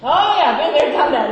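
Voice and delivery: a big loud echo of a listener's oh yeah; mock loud oh yeah. Preacher echoing a listener's reply, loud mock agreement. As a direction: throw the listener's answer back at them, loud and high. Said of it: "Is that what you said?".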